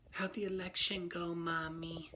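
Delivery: put-on high, drawn-out little-kid voice; young son's voice. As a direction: imitate a child asking his mommy from bed. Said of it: "her son was in bed sleeping, and he's like".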